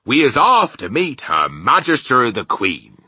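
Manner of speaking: loud, high proclamation; excited cry. A narrator's reading. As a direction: announce it grandly and loudly. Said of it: "cried the B F G".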